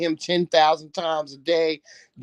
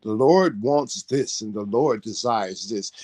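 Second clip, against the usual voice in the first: lower, prim churchy voice mocking the pious; mock prudish church talk; 5 semitones lower than usual. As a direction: parody stiff church folk in a lower, prim tone. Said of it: "always acting prudish".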